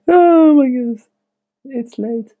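high-pitched yawn through the words; sleepy yawn, voice sliding up high. Real, not acted. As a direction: talk through a big late-night yawn. Yawns late at night.

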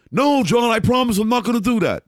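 high, earnest protest in a put-on voice; hammed-up innocent promise; mock pleading Gary. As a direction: play the colleague swearing he won't betray you.

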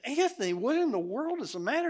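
put-on high, puzzled call to a crying child; bewildered uncle questioning the boy; baffled question. Ron retells asking the crying boy.

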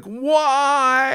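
high, wailing mock sob of why; hammed-up high crying wail; imitating ugly crying. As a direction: wail it like a tearful skater on the ice. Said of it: "ugly, like".

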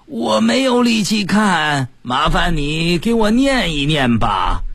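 high, very slow invalid's wail; weak patient. Acted. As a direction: drag it out like a hammed-up sick patient. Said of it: "病人说".

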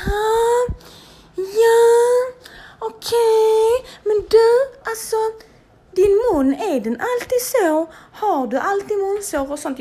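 high, loud put-on voice of the snooty receptionist; mocking receptionist voice. Receptionist talking down to patient, high mocking voice.

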